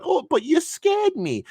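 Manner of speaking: high, slow mock whimper; mocking whine. Mocking the teammate who shot him, slow whiny voice. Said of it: "[name] keeps going".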